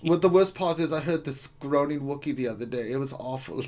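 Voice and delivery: put-on German accent, mock complaint. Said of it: "in your German accent".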